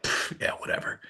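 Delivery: soft scoffing brush-off; dismissive scoff. Retold or imitated. Brushing off dad's encouragement, soft dismissive scoff. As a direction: scoff it away under your breath. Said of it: "I was like".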